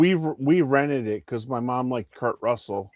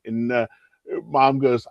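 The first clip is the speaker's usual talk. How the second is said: ordinary delivery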